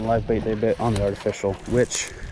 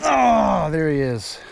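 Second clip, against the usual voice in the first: excited; 6 semitones higher, slower than usual. Real, not acted. Friend hooks a big fish.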